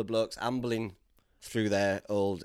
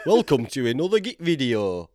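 loud, higher hammed-up Yorkshire welcome; exaggerated Yorkshire accent; 5 semitones higher, 7 dB louder than usual. Dub over his southern videos, exaggerated Yorkshire accent. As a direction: belt out a broad mock Yorkshire intro. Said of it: "Turn up the Yorkshire".

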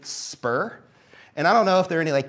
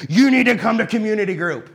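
pushy nag; 5 semitones higher, 6 dB louder than usual. Pretend. Mock pushy churchgoer in your face.

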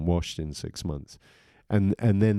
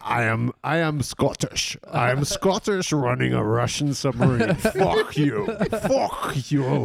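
higher Scottish-tinged mock Russian accent; mock Sean Connery Russian; 6 semitones higher than usual. Mocking Sean Connery's Russian accent to friends. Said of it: "to do a Russian accent".

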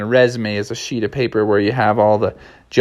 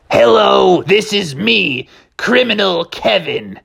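criminal voice; 9 semitones higher, slower than usual. Acted. Criminal character voiced high.

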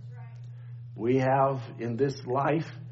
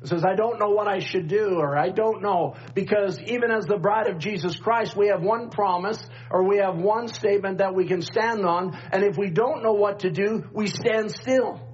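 preaching; 6 semitones higher than usual. Acted. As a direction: preach it with rising fire. Rising preaching at full force.

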